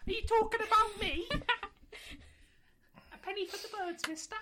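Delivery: high, squeaky street urchin voice; Victorian street urchin. Retold or imitated. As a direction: beg like a cheeky Victorian urchin. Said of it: "a Victorian street urchin and went".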